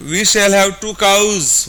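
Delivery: very slow, word by word English demo; slow dictation. Shown as a demonstration. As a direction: spell out each word slowly for learners. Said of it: "बोल के बताएंगे". (धीरे-धीरे शब्द-शब्द बोलना)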